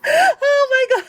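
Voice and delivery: high, loud delighted squeal; squealing excitement. Real, not acted. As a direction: squeal over something adorable. Sees cute cat figure.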